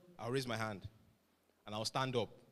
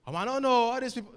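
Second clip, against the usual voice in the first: higher, louder, careless brush-off; dismissive mock answer; 10 semitones higher, 6 dB louder than usual. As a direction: wave off the question like it's nothing. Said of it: "why Israel and Palestine".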